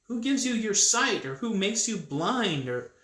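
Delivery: loud, high-pitched indignant questioning; acted exasperation, ending in a sigh and a high push; exasperated rebuke. God rebukes reluctant Moses.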